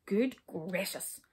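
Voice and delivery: slow, dismayed exclamation; upset. A narrator's reading. King learning they are only brownies, mildly upset. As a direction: take the news in heavily. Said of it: "said the king in a terrible upset".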